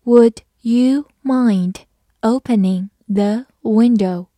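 slow, careful word-by-word read. Shown as a demonstration. Teacher reading slowly for students. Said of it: "慢慢来读".

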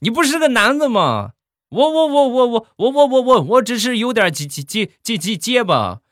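put-on high, indignant stuttering old man; hammed-up stutter, stuck on repeated syllables; angry stammerer. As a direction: splutter in high-pitched outrage. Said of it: "大爷当时就火了".

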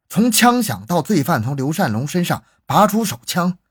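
ordinary delivery, nothing marked

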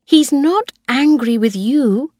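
usual speaking voice

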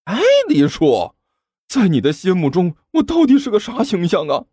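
high-pitched, wailing complaint; tearless despair. A narrator's reading. Lad asking what the uncle thinks of him, despairing. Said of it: "甘球欲哭无泪地看着李叔说道".

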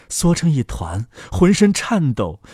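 usual speaking voice

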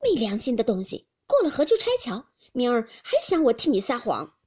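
loud, indignant reproach; teeth-gritted scolding. A narrator's reading. Maid rebuking Jia Lian for snatching the hair, loudly indignant. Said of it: "平儿咬牙道".

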